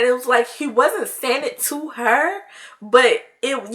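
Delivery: usual speaking voice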